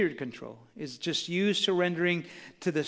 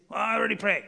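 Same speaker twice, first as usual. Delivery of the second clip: dismissive; 5 semitones higher, faster than usual. Retold or imitated. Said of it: "they do it like this".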